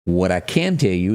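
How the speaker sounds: normal talking voice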